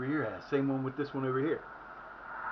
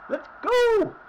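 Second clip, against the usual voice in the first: joyful yell; 13 semitones higher, much slower than usual. Real, not acted. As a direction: scream it out in triumph. Celebrating goal, crying out.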